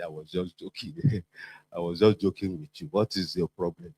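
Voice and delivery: soft sheepish laughing excuse; mock innocent backpedal. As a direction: play the boss pretending it was a joke. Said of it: "come back and say".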